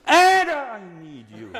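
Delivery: slow, loud, pressing; urgent plea. Shown as a demonstration. Coach showing the singers the urgency, slow and loud. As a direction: lean into the need. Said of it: "It's also the idea".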